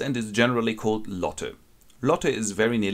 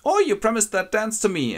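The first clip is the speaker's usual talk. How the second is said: put-on playful girl reminding Lotte, pitched up high; high girlish friend voice; 13 semitones higher, 4 dB louder than usual. Playful friend teases Lotte about the promised dance, high-pitched. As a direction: lift into a high, chirpy girl voice.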